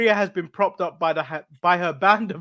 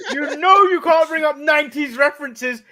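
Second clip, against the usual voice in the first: laughing protest; 6 semitones higher, 5 dB louder than usual. Real, not acted. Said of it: "Don't you dare do that to me when I'm drinking".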